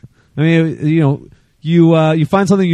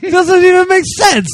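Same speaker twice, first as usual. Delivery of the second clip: imitating a flustered angry caller, much higher; mock frustrated outburst; 14 semitones higher than usual. Host mimics an angry caller: mock sputtering frustration. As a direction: sputter it like someone too mad to make sense. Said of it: "people get so frustrated and angry that they can't even".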